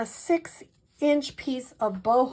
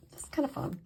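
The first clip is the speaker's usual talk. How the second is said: breathy whisper, half to herself; whispered aside; 4 semitones higher, 11 dB quieter, faster than usual. Real, not acted. Crafter confiding to viewers that she invents words, whispered. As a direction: let it slip out in a whisper.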